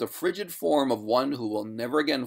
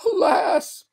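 very high, loud lament; ghost crying out; 8 dB louder than usual. A narrator's reading. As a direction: wail it like a despairing ghost. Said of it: "cried the ghost".

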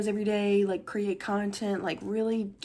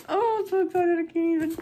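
high, loud burst of excitement; real excitement; 9 semitones higher, 4 dB louder than usual. Real, not acted. Vlogger about to open a gift box, high excited squeal. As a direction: squeal it out, overexcited.

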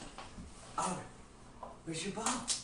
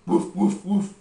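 dog woof; 5 semitones higher, 14 dB louder than usual. Pretend. Animal sound for toddler.

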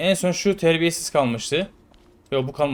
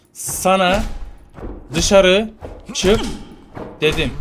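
mock angry order; 4 semitones higher, 3 dB louder, much slower than usual. Guard angrily ordering prisoner out.

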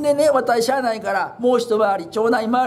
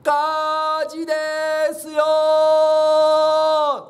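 long drawn-out yell, pitched up high; stretched panic cry of fire; loud shout of fire; 5 semitones higher, much slower than usual. Retold or imitated. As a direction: bellow it out to wake the whole town. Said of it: "思わず大きな声で".

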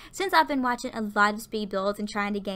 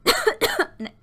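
loud cough into the mic; sudden throat-clearing coughing fit; real cough; 10 semitones higher, 6 dB louder than usual. Real, not acted. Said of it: "I keep coughing".

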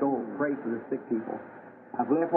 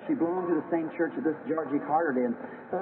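ordinary delivery

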